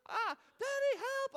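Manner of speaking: put-on loud, very high panicked child; little girl calling. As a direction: imitate a small child yelling for dad. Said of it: "all of a sudden I hear".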